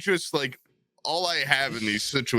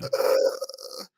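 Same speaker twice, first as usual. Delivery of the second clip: voiceless, drawn-out mock groan; wordless stupefied noise; dazed groan. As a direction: act out a stoned, blank "ugh". Acts out being speechless among streamers.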